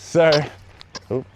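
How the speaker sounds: ordinary talk, nothing marked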